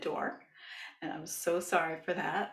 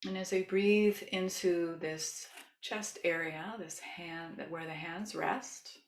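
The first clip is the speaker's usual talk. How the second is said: soothing yoga teacher. Shown as a demonstration. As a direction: gently lead a loving kindness meditation. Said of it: "as I start to use my yoga voice".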